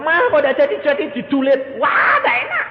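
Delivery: put-on loud, high-pitched child's whine; complaining child. Child complains to mother about unfinished bread, loud high whine. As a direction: act a kid loudly protesting. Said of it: "anakmu bilang".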